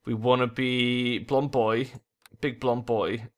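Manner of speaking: normal talking voice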